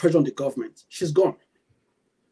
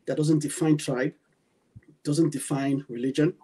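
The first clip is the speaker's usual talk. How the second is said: ordinary talk, nothing marked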